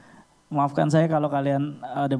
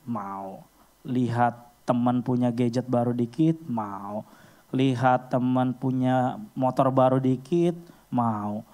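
normal talking voice

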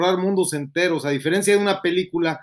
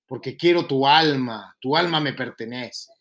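Satan voice; 5 semitones lower, 4 dB quieter than usual. Pretend. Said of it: "yo soy Satanás".

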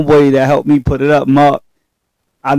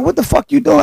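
mock startled friends; 12 semitones higher than usual. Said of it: "they like".

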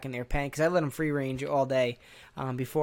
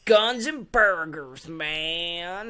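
slow, loud, higher foreigner's fake Texas accent; 3 semitones higher, 4 dB louder, much slower than usual. Foreigners mocking Americans with a slow, loud fake Texas accent. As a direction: ham up a bad Texas cowboy accent. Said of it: "try to do a fake Texas accent".

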